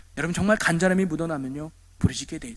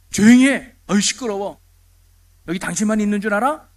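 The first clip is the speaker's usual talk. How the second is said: acted scolding; 6 dB louder than usual. Crowd rebuking the shouting beggar, loud scolding. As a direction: tell off a noisy man. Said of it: "사람들이 꾸짖었다".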